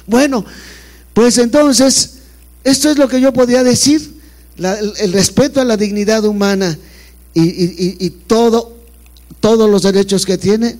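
emphatic speech. Real, not acted. Obispo habla con énfasis.

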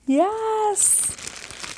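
usual speaking voice

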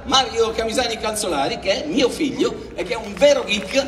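big, high presenter's build-up; announcer voice. Acted. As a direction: announce him like a show host. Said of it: "con la voce adatta".

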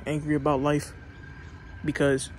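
loud, fired-up motivational speech; loud preaching pep talk. Real, not acted. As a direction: pump them up like a coach. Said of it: "Pep Talk, Motivation Talk".

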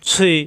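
"সুই" as heavy s gliding to i; heavy letter. Shown as a demonstration. Teacher shows the heavy letter with kasra, rounded "সুই". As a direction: hiss into full-mouthed "সুই". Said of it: "জিহ্বা নিচের দাঁতে চাপ দিয়ে".

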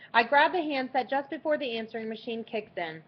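ordinary delivery, nothing marked

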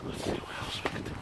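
breathy hiding whisper. Real, not acted. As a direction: whisper so no one hears. Hiding under a truck.

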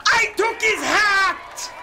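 high, slow gloating yell; victory shout over a prize; triumphant shout. Real, not acted. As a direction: roar it out like you stole a crown. Triumphant over seizing Pope's hat.

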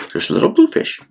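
pointing aside. Real, not acted. Reader pointing out the fish to a child, delighted.

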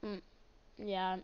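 normal talking voice

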